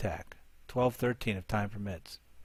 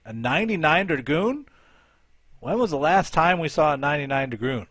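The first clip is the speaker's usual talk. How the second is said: higher, louder incredulous question; real surprise; 4 semitones higher, 7 dB louder than usual. Real, not acted. Commentator spotting a rare ninety-nine Dragoon attack, surprised. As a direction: react with raised, loud disbelief.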